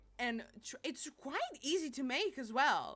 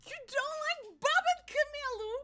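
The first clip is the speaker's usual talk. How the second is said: high, loud shocked question; real scream; 6 dB louder than usual. Real, not acted. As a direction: yell it in shock. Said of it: "I'm screaming in your ear".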